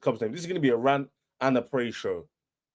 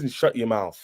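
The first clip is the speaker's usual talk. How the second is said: ordinary talk, nothing marked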